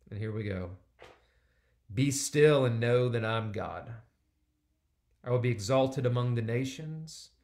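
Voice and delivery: loud, measured scripture reading. Acted. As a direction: proclaim the verses to the congregation.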